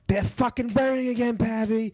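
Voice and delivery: high-pitched, urgent call. Retold or imitated. Housemate knocking on the door to report burning, urgent calling out.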